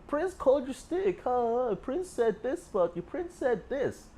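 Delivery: high, slow, taunting gossip; mocking snitch voice. Friend taunting the ex about [name] with a high, slow, mocking whine. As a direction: tattle on him in a whiny high voice. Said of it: "he didn't even probably say in this voice".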